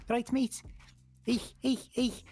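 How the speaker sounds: high-pitched exaggerated Scouse; Darren, Scouse. Shown as a demonstration. Roleplayer finding his character's accent, high Scouse. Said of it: "With Darren, it's kinda like".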